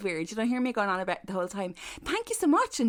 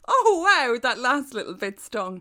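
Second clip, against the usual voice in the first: mock hurt; 8 dB louder than usual.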